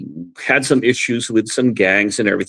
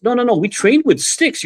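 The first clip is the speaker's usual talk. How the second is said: friend explaining; 10 semitones higher than usual. Retold or imitated. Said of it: "And he goes".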